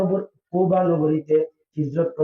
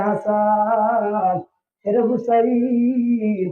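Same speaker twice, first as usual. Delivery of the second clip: slow, drawn-out chanted names; calling out; 6 semitones higher, much slower than usual. Acted. Bilal calling Hasan and Hussain, long chanted call. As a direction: call each name on a long held note. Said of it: "ডাক দিয়ে কইরে".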